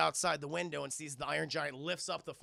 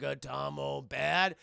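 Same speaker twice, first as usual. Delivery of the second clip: slow, simple-minded question; childlike giant robot; 3 semitones lower, 4 dB louder, slower than usual. Retold or imitated. Iron Giant asking Hogarth about Atomo, slow and childlike. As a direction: ask it slowly, like a learning giant.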